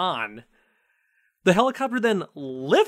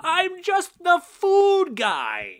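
high, slow, drawn-out catchphrase; quoting the food guy; 12 semitones higher, much slower than usual. Retold or imitated. Host quoting Emilio's line, high slow and drawn out. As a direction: stretch the catchphrase out proudly. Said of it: "with the line".

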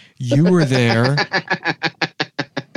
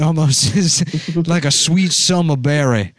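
slow Southern dandy, breaking into laughter; hammed-up, drawn-out Southern drawl; slower than usual. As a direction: savor it like a Southern gentleman. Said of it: "My cool Southern dandy voice".

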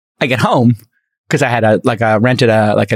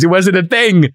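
excited; 6 semitones higher than usual. Real, not acted. Still excited about cheap wine.

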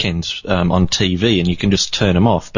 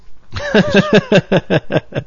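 a burst of high laughter; 14 semitones higher than usual. Real, not acted. Host laughing at his joke about being broke, high laugh.

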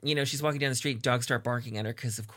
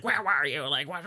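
mock yelling; 3 semitones higher than usual. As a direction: scold like an irate boss, half-seriously. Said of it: "Hader starts yelling at her".

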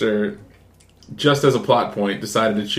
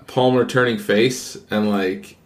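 normal talking voice

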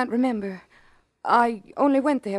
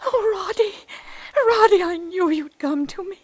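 weep with relief at his return; tearful joy; 6 semitones higher than usual. Acted. Mother hears dead son's voice.

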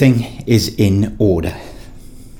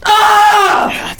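pained shout. Acted. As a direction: yelp as you're kicked and fall. Kicked, falls backwards.

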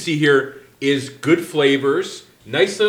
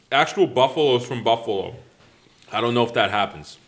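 ordinary talk

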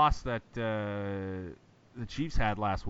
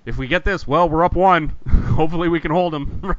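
louder, higher team pep talk; excited; 6 semitones higher, 9 dB louder than usual. Retold or imitated. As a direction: pump it up like a hopeful player. Acting out the team's thinking.

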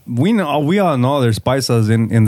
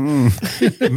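wary, drawn-out hmm while laughing; laughing mock-suspicious hum. Shown as a demonstration. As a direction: eye them up with a doubtful hum. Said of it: "do it again".

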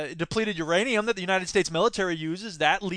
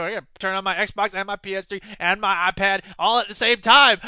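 normal talking voice

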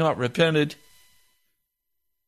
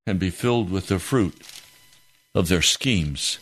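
solemn scripture reading; 6 semitones lower than usual. Acted. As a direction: let each phrase of Proverbs land heavily. Reads scripture before praying.

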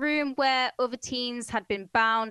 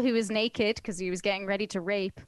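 ordinary talk, nothing marked

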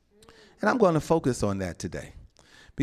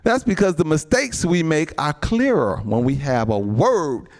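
loud, driving sermon voice; rising preacher's fervor; full-force preaching; 5 dB louder than usual. Acted. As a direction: preach it hard to the saints.

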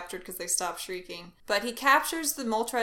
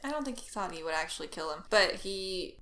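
normal talking voice

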